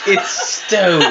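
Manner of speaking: high, loud laughing objection. Real, not acted. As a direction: crack up while correcting the player. Table cracks up at carving plan.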